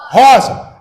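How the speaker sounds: loud, punched single word; confident crowd answer. Retold or imitated. Audience answers the speaker all at once, confident shout. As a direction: call it out like a sure audience.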